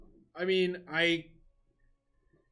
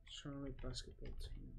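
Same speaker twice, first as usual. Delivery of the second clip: whispered to self; 3 semitones lower, 14 dB quieter than usual. Real, not acted. Muttering a search to himself.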